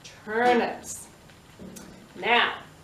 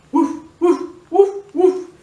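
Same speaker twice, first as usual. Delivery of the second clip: loud, yappy woof woof like a dog; dog barking; 7 dB louder than usual. Acted. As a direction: bark it out like a friendly dog for the kids. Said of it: "Here was a dog".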